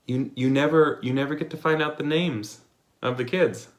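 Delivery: usual speaking voice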